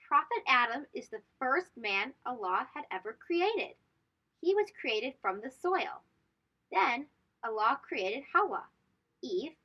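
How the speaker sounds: normal talking voice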